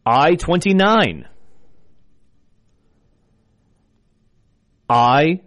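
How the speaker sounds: slow, drawn-out number calling; bingo caller. Acted. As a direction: call each number clearly, then repeat. I will be your bingo caller.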